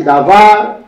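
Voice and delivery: davar with VAR stressed, loud; stress on last syllable. Shown as a demonstration. Teacher showing students where davar is accented, last syllable stressed. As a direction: lean hard on the second syllable of davar. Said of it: "désigner la dernière syllabe var".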